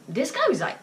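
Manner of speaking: ordinary talk, nothing marked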